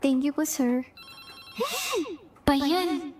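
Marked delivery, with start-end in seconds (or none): 1.6-2.1 sharp high startled gasp; shocked gasp. Acted. Applicant gasping as she recognizes her interviewer, shocked. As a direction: gasp in shock at seeing him.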